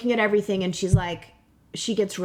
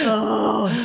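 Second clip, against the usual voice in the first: tearful oh; 2 semitones higher than usual. Real, not acted. Crying after painful memory.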